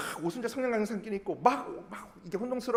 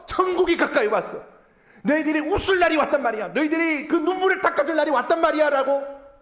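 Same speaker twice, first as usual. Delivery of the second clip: loud, higher-pitched call to the crowd; acted joyful proclamation; 7 semitones higher, 7 dB louder than usual. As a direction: proclaim good news as Jesus to his followers. 예수님의 복음 선포를 연기. (목소리 높여 외치는 복음 선포)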